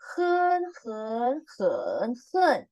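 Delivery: hen through the four tones; four Mandarin tones. Shown as a demonstration. Teacher drilling tones on hen. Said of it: "ikutin aku membaca".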